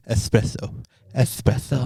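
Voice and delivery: "Espresso" with s softened to th, quietly; lisped espresso. Shown as a demonstration. Showing co-host how they said "Espresso" all day, lisped. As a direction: lisp the s sounds in "Espresso". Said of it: "Been saying it with a lisp".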